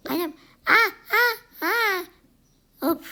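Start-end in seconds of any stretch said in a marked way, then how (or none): 0.7-2.0 high loud scream of falling; scared scream. Acted. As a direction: scream as you lose balance. Falling from the fridge. (korkuyla çığlık)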